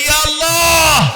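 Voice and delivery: high, slow, drawn-out cry to God; loud call. Shown as a demonstration. Preacher leading the congregation in prayer, loud drawn-out call. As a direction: lead the crowd in a loud call. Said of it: "জোরে বলি".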